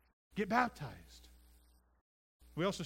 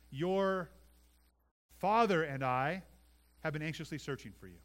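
father leaned on, louder, slowed; stress on father; 5 dB louder than usual. Shown as a demonstration. Pastor pointing out Mary's words, "father" stressed. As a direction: hang on the word "father" before going on. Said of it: "Listen to what she says".

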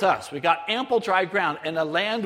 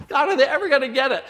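frustrated plea; 7 semitones higher, 5 dB louder, faster than usual. Retold or imitated. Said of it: "I'm getting frustrated".

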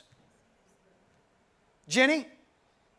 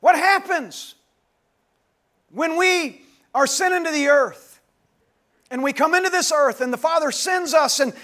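louder and higher, calling out to the congregation; preaching at full force; 5 semitones higher, 10 dB louder than usual. Acted. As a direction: build the sermon to a ringing climax. Rising sermon climax.

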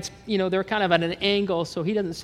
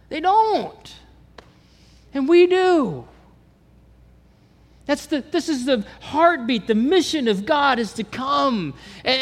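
louder, higher preaching at full force; 6 semitones higher, 5 dB louder than usual. Acted. Pastor urging the congregation, at full force. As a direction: drive the message home to the church.

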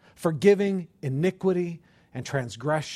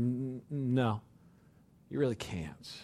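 soft, halting no, much softer; gentle God voice; 4 semitones lower, 8 dB quieter than usual. Acted. God telling the people they can't keep the law, softly. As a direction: let them down quietly as God.

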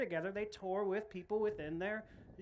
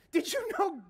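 amazed question; 7 semitones higher, slower than usual. Real, not acted. Amused aside to congregation.

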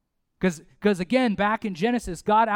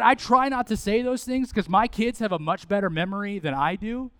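usual speaking voice

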